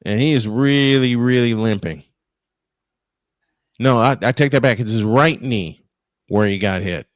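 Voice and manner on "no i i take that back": ordinary delivery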